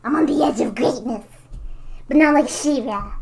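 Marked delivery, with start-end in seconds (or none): 0.0-1.2 whispering. Acted. Dramatic villain boast.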